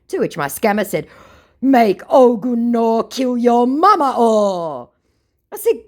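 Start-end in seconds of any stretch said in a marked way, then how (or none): 1.6-4.9 slow, high, loud Nigerian Pidgin; a mock Nigerian Pidgin threat. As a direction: lay on the Nigerian Pidgin like a menacing scammer.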